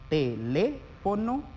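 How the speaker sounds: "teléfono" slowly, syllable by syllable; dividido en sílabas. Shown as a demonstration. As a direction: break "teléfono" into drawn-out syllables, te-lé-fo-no. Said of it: "la división silábica". ("teléfono" despacio, sílaba por sílaba)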